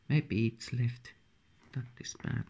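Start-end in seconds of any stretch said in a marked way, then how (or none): 1.7-2.4 soft disappointed whisper. Real, not acted. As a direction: breathe it out, let down. Sees a bad disc.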